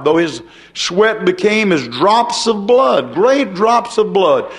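slow, rising sermon cadence; preacher building to a slow, fervent crescendo; slow building preaching. Real, not acted. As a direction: draw each phrase out, climbing toward the climax.

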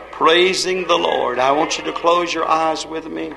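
quiet, reverent invitation, leaving space between phrases; hushed altar call. Real, not acted. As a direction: gently draw the congregation into prayer.

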